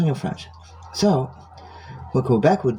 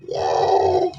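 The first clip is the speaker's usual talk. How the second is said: higher, drawn-out little cheer; mock celebration. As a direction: end on a high, squealed yay. Mock celebration of patrol stopping.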